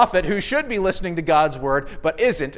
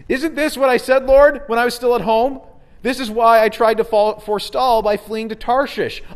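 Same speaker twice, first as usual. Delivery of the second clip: raised, higher-pitched angry complaint to God; indignant, louder prayer to the Lord; 6 semitones higher, 5 dB louder than usual. Retold or imitated. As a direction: protest at God like a wronged prophet. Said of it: "prays in a very angry tone".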